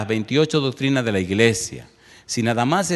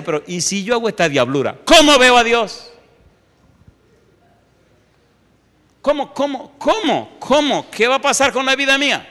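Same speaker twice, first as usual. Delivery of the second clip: fearful self-quote; 11 semitones higher, 5 dB louder than usual. Retold or imitated. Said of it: "Pues yo decía".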